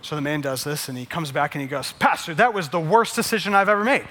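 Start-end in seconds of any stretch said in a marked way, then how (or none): 1.9-4.0 higher, louder acted outburst of grievances; rattled-off indignant griping; exasperated complaint. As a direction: play a fed-up farmer venting to his pastor. Farmer returns to complain to pastor.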